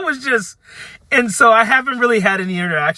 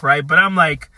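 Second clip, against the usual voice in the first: usual speaking voice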